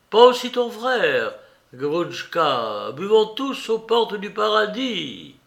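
loud, swinging invitation to drink; boisterous call to raise glasses; rousing toast. A narrator's reading. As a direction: propose a toast with big rising swoops. Rowdy toast offered by Rakitin.

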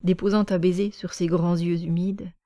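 plain reading voice

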